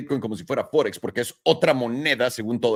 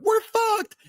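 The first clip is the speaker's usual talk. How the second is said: very high squeaked cry of doom; hammed-up tiny peso shrieking; squeaky peso voice. The peso voiced as warning everyone.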